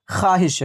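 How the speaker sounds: ख्वाहिश as "khaahish", no w; correct pronunciation. Shown as a demonstration. Teacher answers learners, ख्वाहिश said khaahish. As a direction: leave the waw silent in ख्वाहिश. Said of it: "सही इसका तलफ़ुज़ क्या है?".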